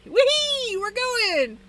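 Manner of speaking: high, giddy playful voice; silly clown. Retold or imitated. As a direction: play it like a kid with new toys. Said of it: "You'll just kinda be like".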